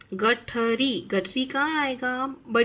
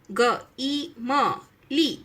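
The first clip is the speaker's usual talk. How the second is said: slow letter by letter; 2 semitones higher, much slower than usual. Shown as a demonstration. Spelling out letters to join.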